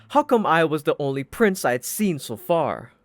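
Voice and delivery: ordinary delivery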